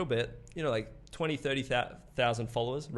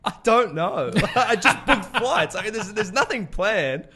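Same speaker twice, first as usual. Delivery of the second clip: laughing; 9 semitones higher, 10 dB louder than usual. Real, not acted. Laughing at his own plan.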